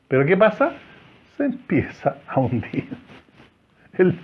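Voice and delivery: normal talking voice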